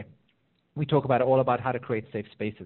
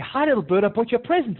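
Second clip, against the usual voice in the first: high, louder sing-song greeting, acted out; excited coaxing; 9 semitones higher, 5 dB louder than usual. Person luring the little bird with a present, acted excited coaxing. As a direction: coax a small pet with mock excitement.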